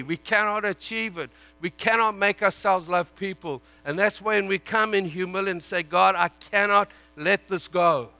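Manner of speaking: loud, driving sermon voice; fervent preaching. Acted. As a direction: preach it hard to the congregation. Preaching at full force.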